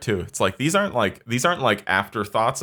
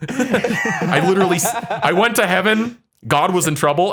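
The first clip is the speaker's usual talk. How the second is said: indignant; 7 semitones higher, 4 dB louder than usual. Retold or imitated. Indignant rant about Neo.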